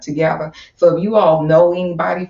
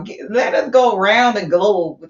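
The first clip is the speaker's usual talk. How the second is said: ordinary talk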